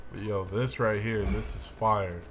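ordinary talk, nothing marked

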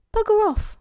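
high, loud, annoyed retort; angry hiss. A narrator's reading. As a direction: lash back after a kick. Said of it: "he hissed".

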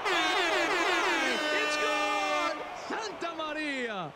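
huge high shouted home run call; berserk rage. Acted. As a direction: scream it over the crowd.

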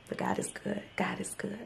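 soft whispered thanks; grateful whisper. Real, not acted. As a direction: whisper it like a prayer. Quiet thankful prayer aloud.

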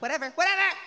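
high, loud dismissive "whatever"; high mocking girl voice. A high, loud brush-off to the guy who called. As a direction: brush him off in a high girly voice. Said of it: "I was like".

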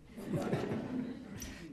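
short breathy laugh at himself; amused laugh. Real, not acted. Journalist laughing at being called lamestream media, short amused laugh. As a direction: laugh off the irony of it.

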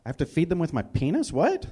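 quick question jumping high on "What"; baffled disbelief. Acted. Viewer mishearing the charity commercial, baffled and incredulous. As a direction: blurt it out, incredulous.

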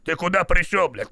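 ordinary talk

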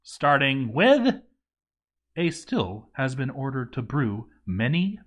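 ordinary talk, nothing marked

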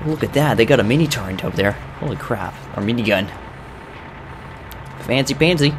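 usual speaking voice

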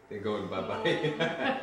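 drawn-out Boston-accented bye-bye. Real, not acted. Husband joking about where they went, in a Boston accent. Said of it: "making fun of my Boston accent".

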